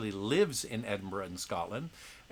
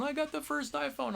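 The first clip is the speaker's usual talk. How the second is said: mock-excited fan, voice jumping much higher. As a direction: imitate a giddy first-day iPhone buyer. Mimicking excited iPhone buyers.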